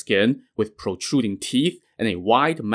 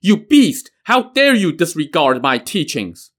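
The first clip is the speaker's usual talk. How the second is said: enraged yell, much higher and louder; furious shout; 6 semitones higher, 4 dB louder than usual. Acted. Master in a rage at his defiant pupil, furious shout. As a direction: explode at the pupil as you draw the sword. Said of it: "Pure Essence flew into a rage".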